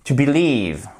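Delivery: "believe" with "lieve" strong; stress on lieve. Shown as a demonstration. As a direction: lean on the second syllable of "believe". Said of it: "on entend fortement la seconde". (accent tonique sur « lieve » de « believe »)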